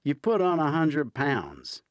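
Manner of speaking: high, loud playful jab at a friend; teasing. A narrator's reading. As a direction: rib him with a grin in the voice. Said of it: "Hunter teases".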